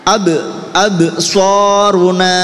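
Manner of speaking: "ab" with bouncing b, "soruna" slow and drawn out; qalqalah. Shown as a demonstration. Teacher leads a repeat-after-me drill, qalqalah on "ab". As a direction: bounce "ab", then stretch "soruna". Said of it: "Nggih, qalqalah".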